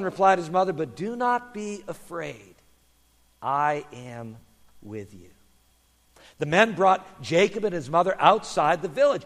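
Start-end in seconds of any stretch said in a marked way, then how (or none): 0.7-5.3 softer, lower, slowing to a hush; reassuring. Acted. Mother donkey comforts her son, tender and reassuring. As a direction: soothe a worried child gently.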